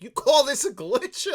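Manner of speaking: high, loud, slow incredulous question; mock outrage. Boss answering Dick's glitch excuse, mock outraged and loud. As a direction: throw it back in acted disbelief. Imitates boss's outraged reply.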